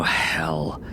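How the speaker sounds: ordinary delivery, nothing marked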